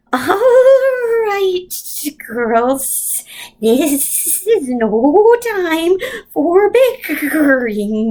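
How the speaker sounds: high, very slow eerie voice; strange voice. A narrator's reading. Mrs Witch scolding the other two, high slow strange voice. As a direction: drawl it out like a ghost. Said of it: "strange voice cut in".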